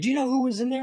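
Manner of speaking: very high imitated demand; agitated janitor. Janitor asking who was in there, agitated and high. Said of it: "kinda agitated".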